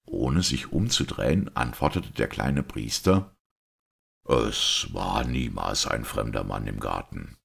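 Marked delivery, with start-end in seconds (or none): 4.2-7.4 lower-pitched, restrained priest voice; composed priest. Acted. Priest asking everyone to hear him out, composed. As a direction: play a priest calmly setting out the truth.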